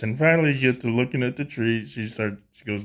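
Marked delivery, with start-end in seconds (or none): none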